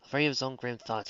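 ordinary delivery, nothing marked

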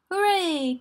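high, loud cheer; joyful cry. A narrator's reading. Tigger bouncing from room to room, joyful cry. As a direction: let out a gleeful cheer. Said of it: "cried Tigger".

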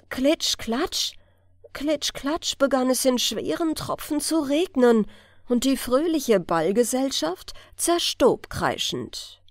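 usual speaking voice

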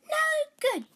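dismissive princess. Acted. Princess rejecting the first clever son, high and dismissive. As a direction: reject him flatly from on high.

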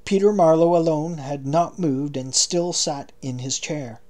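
plain reading voice

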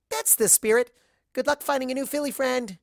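high, bubbly, overexcited party pony; high giddy pony gushing about kissing; Pinky voice. Acted.

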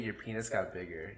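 usual speaking voice

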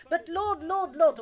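high, pleading mock alarm; anxious protest. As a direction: plead urgently as a worried follower. Disciples protest to Jesus.

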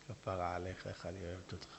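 soft loving wife calming an angry husband; tender wife. Acted. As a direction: melt him with sweetness. Wife soothing her husband.

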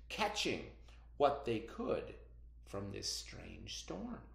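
slow, hushed folk tale narration with bright peaks; storytelling read-aloud. Acted. Teacher reading a folk tale to her students, slow and hushed.

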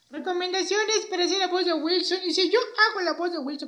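high-pitched puppet character; Beto el muñeco. Acted. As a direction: pipe up as a chirpy little puppet. Voz del muñeco Beto. (voz aguda de muñeco)

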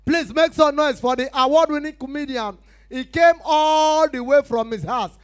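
very loud, high MC build-up; event announcer. Acted. MC calling the comedian on stage, booming announcer. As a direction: stretch the name into a long final shout.

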